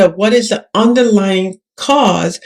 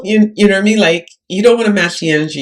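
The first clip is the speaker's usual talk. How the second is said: ordinary delivery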